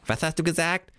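put-on high puzzled old voice; hard-of-hearing raccoon. Deaf raccoon asking again, high puzzled voice. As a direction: ask like you can't hear.

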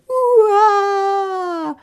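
high loud wailing cry; a toddler's howling wail; baby wail. A narrator's reading. Little Tiki Taka bawling in the kitchen, loud wail. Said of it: "Tiki Taka piange".

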